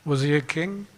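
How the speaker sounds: ordinary talk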